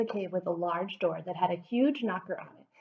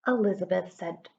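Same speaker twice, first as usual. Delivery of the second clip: ordinary delivery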